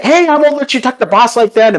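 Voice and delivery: very high, indignant character voice; Daigoyo. Acted. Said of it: "Daigoyo comes out and is like".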